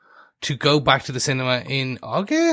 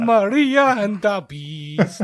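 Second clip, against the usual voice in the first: put-on slow, laughing German accent; hammed-up German accent, slow and amused; 6 semitones higher, slower than usual. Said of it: "it has to be in the German accent".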